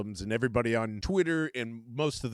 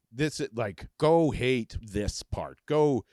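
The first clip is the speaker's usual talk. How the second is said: normal talking voice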